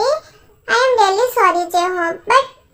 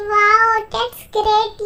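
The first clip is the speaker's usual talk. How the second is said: usual speaking voice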